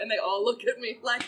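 ordinary talk

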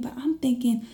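plain reading voice

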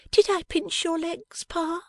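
put-on a bright young wife teasing her Pa; young Bella. Bella teases her father.

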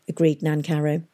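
usual speaking voice

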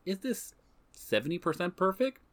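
asks it up high and searching; a light, lifted self-check; high questioning voice. Retold or imitated. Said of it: "same video and says".

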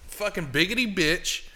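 normal talking voice

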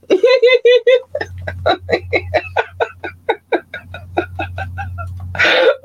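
long high-pitched giggling fit; real giggling fit. Real, not acted. Host cracking up at calling Tennessee a country, giggling fit.

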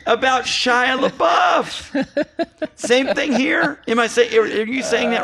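loud, high comic rant, shouting. Acted. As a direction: rail at Michael Bay for laughs. Mock rant at Michael Bay.

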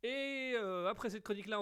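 ordinary talk

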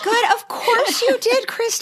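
very high, emotional voice; touched and tender. Real, not acted. Cohost hears author cried at the box, touched. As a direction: coo it, overcome for a friend. Moved by friend's crying story.

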